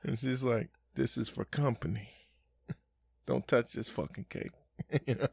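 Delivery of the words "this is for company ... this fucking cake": mimicked mother. Mother scolding kids.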